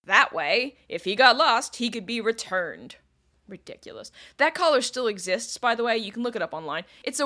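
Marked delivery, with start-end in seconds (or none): 3.5-4.1 breathy disbelieving whisper under the breath; whispered aside. Real, not acted. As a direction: drop to a whisper, scoffing at the idea. Aside on the dog collar.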